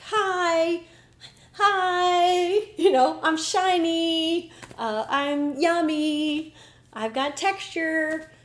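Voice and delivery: high, slow and sugary, calling hi hi; sweet candy voice. Pretend. The M and M part tempting her, high and sugary. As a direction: coax like a shiny candy trying to be liked. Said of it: "'Cause it was trying to be like".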